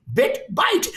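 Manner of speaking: high, puzzled repeating of two words; high bewildered muttering of bit, byte; bewildered inner voice. Retold or imitated. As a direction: sound lost, mulling them over. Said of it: "I'm just thinking".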